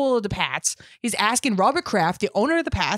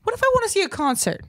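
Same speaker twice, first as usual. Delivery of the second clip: mock eager customer; 9 semitones higher, faster than usual. Fake listener question in ad.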